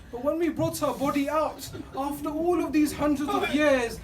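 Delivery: loud, slow, tearful preaching cry; weeping climax. Real, not acted. Preacher wailing to the gathering over the child's wounds, tearful and loud. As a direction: let the voice break into crying as it climbs.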